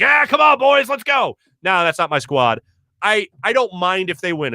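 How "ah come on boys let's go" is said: mock cheering. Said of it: "you won't see me ... being like".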